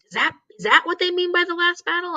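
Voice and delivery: high, far-off voice of sudden confusion; puzzled wondering. Retold or imitated. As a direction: pitch it way up, like it just dawned on you. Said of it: "and I was like".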